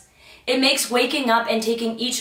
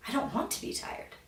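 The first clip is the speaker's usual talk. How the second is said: breathy whisper; a private whispered wish; 3 semitones higher, 14 dB quieter than usual. Acted. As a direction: whisper it to yourself. Rejecting tired self-talk.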